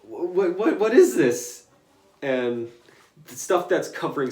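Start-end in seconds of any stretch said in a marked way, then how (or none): 0.0-1.7 high, startled what; panicked shock. Retold or imitated. Cannonball panicking at the attack, high. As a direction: sound suddenly alarmed. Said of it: "Cannonball's like".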